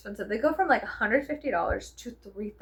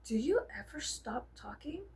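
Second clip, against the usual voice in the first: a dry whispered aside; whispered self-jab; 6 dB quieter than usual. Retold or imitated. Vlogger mocking herself while editing, whispered. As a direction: whisper it to yourself, teasing. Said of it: "I'm like".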